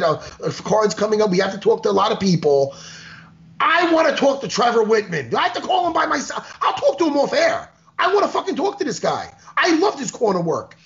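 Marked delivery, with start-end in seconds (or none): 3.6-10.8 wired and high-pitched; buzzing on espresso, rushing; too much caffeine. Real, not acted. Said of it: "Four shots of espresso today 'cause I'm, I'm just hyped up".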